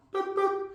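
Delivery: high soft boop boop; a little high dismissal beep; boop sound effect. Acted. Players cut from the roster, soft high boop.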